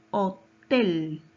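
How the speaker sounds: "Hotel" in two beats, landing hard on "tel"; stressed syllable shown. Shown as a demonstration. Teacher showing where the strongest force is, stressed last syllable. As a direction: punch and hold the final "tel" of "Hotel". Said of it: "¿Dónde está su mayor fuerza de voz?".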